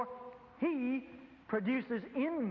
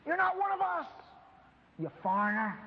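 sneering jab; 7 semitones higher than usual. Acted. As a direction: spit out the final insult, mocking. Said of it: "the law is grinning and says".

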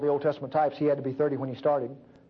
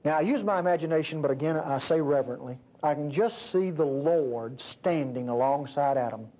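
normal talking voice

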